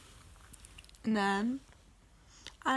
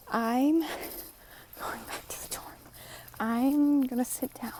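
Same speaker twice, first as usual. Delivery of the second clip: whispering; 3 semitones higher, slower than usual. Real, not acted. Whispering walking back at night.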